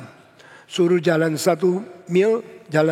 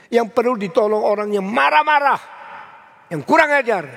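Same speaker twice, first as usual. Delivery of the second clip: rising preaching emphasis; 4 semitones higher than usual. Real, not acted. A preacher urging his congregation to help rude people, raised and emphatic. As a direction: build the point to a peak.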